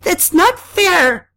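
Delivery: very high, loud, drawn-out scream; anguished shriek of injustice. A narrator's reading. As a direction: scream the protest, stretching it out. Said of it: "I screamed".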